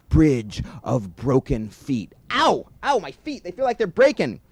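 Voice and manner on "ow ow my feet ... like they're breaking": high loud cries of pain; pained yelps. Acted. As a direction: yelp as your feet hurt. Crossing bridge of broken feet.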